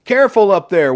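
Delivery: high, loud call from far below; shouted from below. A narrator's reading. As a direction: shout a warning up to them. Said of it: "one of the workers shouted from below".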